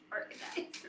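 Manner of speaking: hushed, imitated disbelief; incredulous whisper. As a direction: whisper it as a friend's doubting echo. Quotes friend's doubting reaction.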